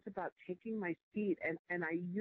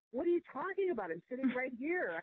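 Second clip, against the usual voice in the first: indignant inner voice; 6 semitones higher than usual. Retold or imitated. She silently protests the board's search, pitched high and indignant. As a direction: replay the outraged thought pitched up. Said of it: "And I was thinking".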